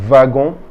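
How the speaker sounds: "wagon" with a French v at the start; va-gon for "wagon", nasal at the end; French pronunciation. Shown as a demonstration.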